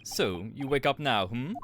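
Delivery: slow, hammed-up Russian accent; terrible Russian accent. Sailor questioning a handcuffed stowaway, terrible Russian accent. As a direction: put on a bad Russian accent. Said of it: "all getting this fucking terrible Russian accent".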